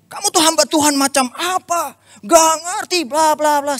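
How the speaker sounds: high-pitched mock rant; angry rant. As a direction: rant at him like an indignant friend. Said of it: "Dia marah-marah lagi". (teman marah-marah dengan nada tinggi)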